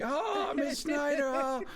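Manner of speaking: slow, high mock swoon. Boys leaning out the van window, high mock swoon. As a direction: stretch it out like a smitten teen.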